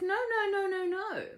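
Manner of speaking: high, loud rapid-fire refusal; emphatic protest. Acted. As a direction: wave away the objection, pitched high. Woman insists, acted story dialogue.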